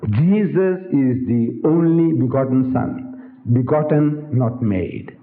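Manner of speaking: slow, measured catechism quote, falling at the end; slow creed recitation. Retold or imitated. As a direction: recite it word by spaced word, dropping on the last.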